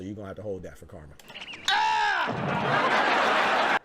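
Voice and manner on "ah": sudden loud laughing yell; a real loud laughing cry; laughing shout. Real, not acted. Laughing at chat's comment.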